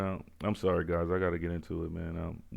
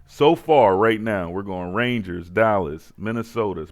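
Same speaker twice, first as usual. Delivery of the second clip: hyped announcement; 10 dB louder than usual. Real, not acted. Hyping up tonight's picks.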